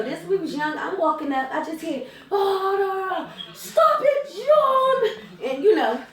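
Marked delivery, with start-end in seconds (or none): 2.3-5.5 high, drawn-out wailing yell. Retold or imitated. Aunt heard from across the street, yelling. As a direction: holler it high enough to carry across the street. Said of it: "I hear yelling all the way across the street".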